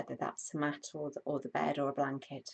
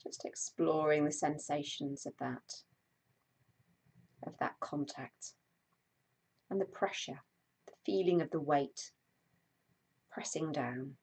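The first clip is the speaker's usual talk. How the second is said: guided meditation; 3 dB louder than usual. Acted. Host guiding a live stream body scan, calm meditation voice. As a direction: ease listeners into stillness, unhurried.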